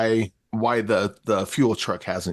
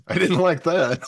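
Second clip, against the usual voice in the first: higher, laughing through the words; laughing joke; 8 semitones higher than usual. Real, not acted. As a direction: tease that the only flaw is it ending. Joking complaint that it ended.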